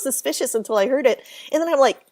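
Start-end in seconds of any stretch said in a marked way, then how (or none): none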